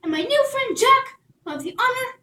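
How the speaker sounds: very high, loud royal announcement; proclaiming. Acted. Princess announcing cake to everyone, high and loud. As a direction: call the whole court. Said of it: "she stood up and proclaimed".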